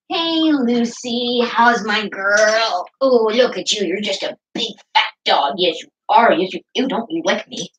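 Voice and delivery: sweet pet-talk voice to the dog; doggy baby talk. Retold or imitated.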